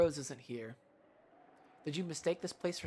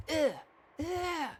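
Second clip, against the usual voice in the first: put-on very high whining child's groans; high drawn-out ugh; tantrum groan; 12 semitones higher, 5 dB louder than usual. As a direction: groan like a sulking little girl.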